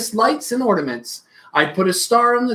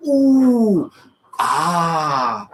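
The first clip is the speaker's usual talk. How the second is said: wonder; 3 semitones lower than usual. A narrator's reading. Family admiring the lit tree.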